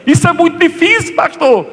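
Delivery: high, pleading complaint to the pastor; the painter complaining to his pastor, pleading; exasperated painter. Retold or imitated. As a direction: whine that it is too hard. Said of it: "Ele fala assim".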